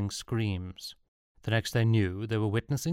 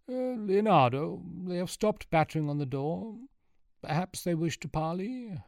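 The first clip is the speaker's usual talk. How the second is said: high, hesitant character voice; timid, anxious lookout; 9 semitones higher than usual. A narrator's reading. Luca reporting to Leonardo from the window, high and timid. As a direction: venture it nervously, pitched well up.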